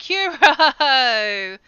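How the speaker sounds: loud, happy hello; excited greeting. Real, not acted. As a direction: call out a cheerful welcome. Greeting a viewer joining the stream.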